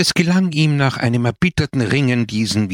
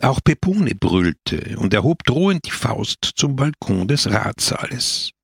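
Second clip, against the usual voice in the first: ordinary delivery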